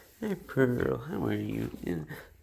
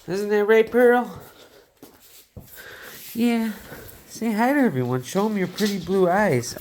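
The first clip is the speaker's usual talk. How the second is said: pet talk; 7 semitones higher, 7 dB louder than usual. Real, not acted. Talking to her dog.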